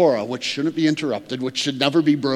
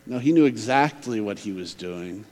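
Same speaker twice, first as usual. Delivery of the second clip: ordinary talk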